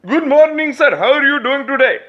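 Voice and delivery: high, loud Indian accent imitation. Clerk greeting the customer, in a hammed-up Indian accent. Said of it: "He's like".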